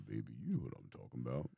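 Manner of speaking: smooth seductive voice. Shown as a demonstration. Continues the smooth lover voice.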